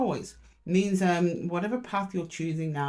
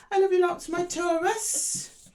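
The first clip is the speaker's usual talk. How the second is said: fond sign-off; 11 semitones higher, slower than usual. Real, not acted.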